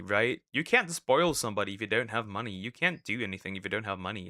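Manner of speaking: ordinary talk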